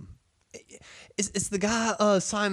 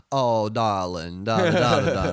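Southern gentleman; 4 semitones lower than usual. Retold or imitated. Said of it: "that's the Charleston area".

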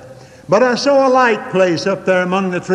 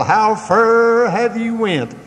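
higher, drawn-out mock holler; imitated preacher's yell; 5 semitones higher, slower than usual. Radio preacher repeating his refrain once too often, slow mock yell. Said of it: "fairly blast the elements by yelling".

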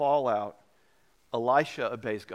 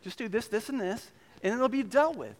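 breezy fix-it advice; 8 semitones higher than usual. Retold or imitated. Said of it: "being a young guy, was like".

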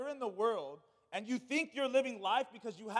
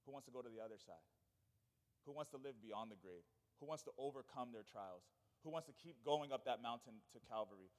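quiet altar call; 9 semitones lower, 11 dB quieter than usual. Acted.

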